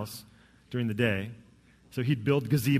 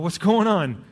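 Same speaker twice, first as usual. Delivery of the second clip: louder and higher, alarmed; startled question; 5 semitones higher, 7 dB louder, slower than usual. Retold or imitated. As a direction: jump at the sudden shriek. Heard kids shriek in garage.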